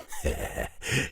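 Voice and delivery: quick soft huff of a laugh; a brief amused laugh; soft chuckle; short laugh. Acted.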